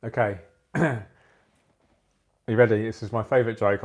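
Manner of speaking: ordinary talk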